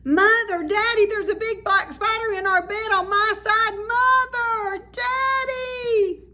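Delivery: high, loud panicked scream; screaming for parents. Acted. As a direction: shriek for Mother and Daddy, voice breaking at the end. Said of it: "went screaming into her mother and father's room".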